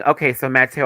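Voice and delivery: usual speaking voice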